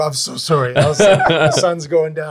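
sheepish laughing apology to the actor. Retold or imitated.

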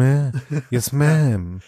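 "Yes, ma'am" with audible breath through the nose; mock Paris, heavy nose breath; breathy Paris imitation. Shown as a demonstration. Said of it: "atmet immer so schön".

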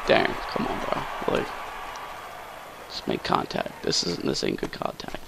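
muttered under his breath, unvoiced; frustrated whisper. Real, not acted. Gamer griping to himself after a weak fly ball, whispered. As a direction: whisper the gripe to yourself.

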